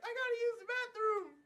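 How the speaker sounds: put-on very high, loud kid voice; squeaky, urgent child impression; mocked kid. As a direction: whine it out loud like a little kid. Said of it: "there's this kid, and he's like".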